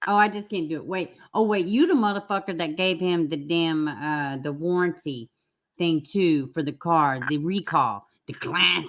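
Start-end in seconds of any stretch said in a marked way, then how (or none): none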